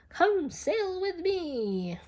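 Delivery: high, slow, inviting whale voice; gentle whale. A narrator's reading. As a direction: coax the snail aboard kindly. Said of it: "said to the snail".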